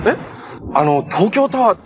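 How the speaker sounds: ordinary talk, nothing marked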